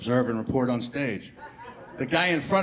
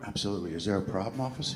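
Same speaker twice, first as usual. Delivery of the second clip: soft, much quieter, innocent; suddenly meek driver, soft and innocent; meek polite reply; 4 semitones lower, 8 dB quieter, faster than usual. Acted. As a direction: play it small and polite to the cop.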